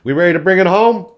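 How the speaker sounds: loud, high, fast rally call; hyped-up call. Real, not acted. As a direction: pump the crowd up for the finale. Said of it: "hyping up the closing segment".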